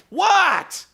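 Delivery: loud, high, irritated "What?"; annoyed shout. Retold or imitated. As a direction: snap back loudly at the pest. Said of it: "Sabin goes".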